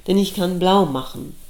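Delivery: usual speaking voice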